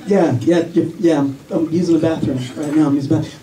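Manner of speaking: low, awkward embarrassed dad; flustered dad. Retold or imitated. Dad caught in bathroom by daughter, low and flustered. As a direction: stammer out an excuse.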